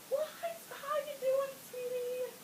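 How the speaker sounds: soft, high, cooing imitation; cooing doctor. Doctor checking on woman in labor, soft cooing. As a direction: coo it softly, high and drawn out. Voices doctor at her ear.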